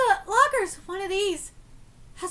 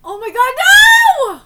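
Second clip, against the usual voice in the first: slow, much louder panicked oh my god no; terrified shout; 6 semitones higher, 17 dB louder, slower than usual. Real, not acted. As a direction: cry out in terror. Jump scare reaction.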